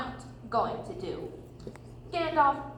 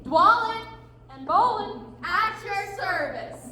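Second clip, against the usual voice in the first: hearty introduction; 5 semitones higher, 4 dB louder than usual. Acted. Dwarf greets hobbit at his door, loud and proud. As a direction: boom out your name and bow. Dwarves announce themselves at door.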